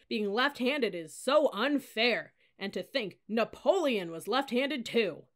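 high, loud, drawn-out sarcasm; slow whiny ridicule; mocking whine. A narrator's reading. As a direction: stretch out the fake sympathy. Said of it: "he whined in a mocking voice".

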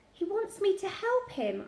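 high-pitched desperate plea. A narrator's reading. Child pleading with the nurse, desperate. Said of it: "I said desperately".

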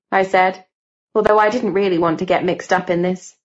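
usual speaking voice

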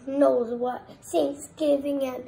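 real, insistent loud voice; loud explaining. Real, not acted. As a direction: belt out each word at full volume. Child explains emphatically to laughing adult.

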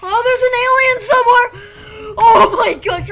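high, loud panicked exclamations; freaking out. Real, not acted. Gamer spotting signs of an alien, freaking out. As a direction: blurt it out in alarm. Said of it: "I'm freaking out now".

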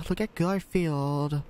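high, slow cartoon cat; Garfield voice. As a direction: drag it out like a lazy cartoon cat. Names Garfield.

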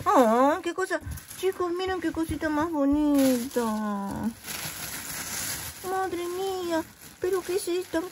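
voz mucho más aguda y maravillada; delighted gushing. Real, not acted. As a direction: exclama encantada al ver el regalo. Abre regalo emocionada. (entusiasmo agudo al abrir el regalo de Paqui)

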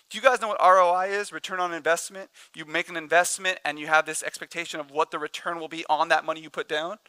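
normal talking voice

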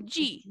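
"Di" modelled as "djee"; Brazilian di sound. Shown as a demonstration. Teacher asking the class to repeat, clear "djee". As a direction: say "Di" for repetition, with a j-like d. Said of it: "Vamos, pronunciem".

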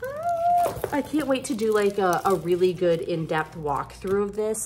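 ordinary talk

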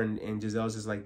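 ordinary delivery

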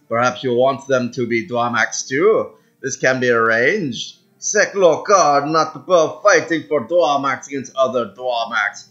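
bargaining alien in a put-on Mediterranean accent; mock Mediterranean-Caribbean alien accent. Alien mercenary offering to fight for a price, mock Mediterranean accent. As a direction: make a shady offer with a loose, lilting Caribbean-tinged accent. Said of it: "why I gave him that accent".

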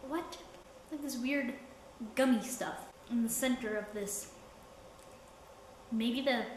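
talking while eating; chewing candy. Real, not acted. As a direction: mouth partly full. Seen 0: holds candy piece at mouth, nibbling and chewing while talking.

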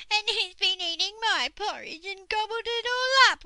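very high, loud, squeaky cub; baby bear. A narrator's reading. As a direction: squeal the complaint as a tiny bear. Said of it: "went the baby bear".

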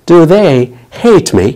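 very slow, hate stressed; slow teaching read. Shown as a demonstration. Teacher models the new question slowly for learners. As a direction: say it slowly, word by word. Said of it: "اليو صارت they".

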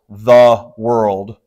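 "the world" as two distinct, drawn-out words; separated words. Shown as a demonstration. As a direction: set "the" apart from "world" with a small gap. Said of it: "a bit of a stop between the two".

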